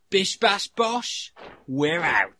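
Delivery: loud, slow, punched sign-off; showy catchphrase. Shown as a demonstration. Showing everyone how it's done, big loud catchphrase. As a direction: belt out each word as a signature sign-off. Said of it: "to show everyone how it's done".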